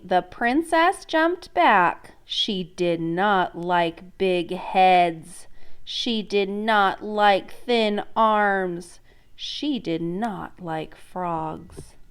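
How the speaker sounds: very slow, deliberate read-along; slow choral reading. Shown as a demonstration. Teacher reading along with the children, very slow. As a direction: read word by word with the class. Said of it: "read it with me".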